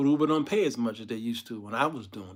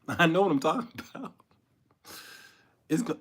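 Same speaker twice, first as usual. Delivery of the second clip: ordinary talk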